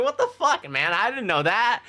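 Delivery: high, loud stunned outburst; shocked disbelief. Retold or imitated. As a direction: blurt it out, caught off guard. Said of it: "And I'm like".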